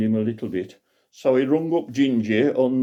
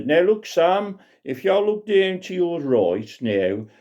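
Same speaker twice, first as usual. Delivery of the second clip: higher, chirpy tour-guide pilot; pilot showing the view; 8 semitones higher than usual. Acted. Pilot showing Grandad Sam the view, cheery raised voice. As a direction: point out the sights like a proud old pilot. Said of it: "Wing Commander Biggleswick, said".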